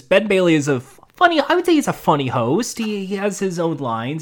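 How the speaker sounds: normal talking voice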